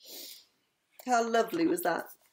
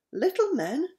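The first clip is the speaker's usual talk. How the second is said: high rising princess question; surprised cry; 9 semitones higher than usual. A narrator's reading. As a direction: echo it high, in eager surprise.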